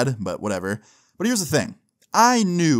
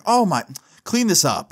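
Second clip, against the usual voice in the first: higher exasperated mock scolding; retold exasperated mom; 7 semitones higher than usual. Mom seeing pee on the floor, exasperated. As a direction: imitate a mom appalled at a mess.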